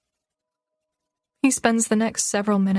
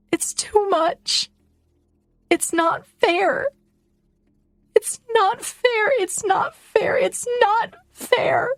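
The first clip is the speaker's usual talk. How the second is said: much higher, slow, voice cracking with tears; breaking into sobs; 11 semitones higher, slower than usual. Acted. Girl breaking down in tears as everything hits her, sobbing. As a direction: let it build into a sob on each repeat.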